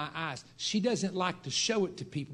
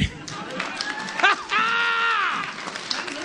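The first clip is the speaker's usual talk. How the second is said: high, loud, rising laugh; 6 dB louder than usual. Real, not acted. Preacher laughing before his big finish, high laugh. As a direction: laugh that launches into a shout.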